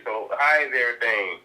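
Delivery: ordinary delivery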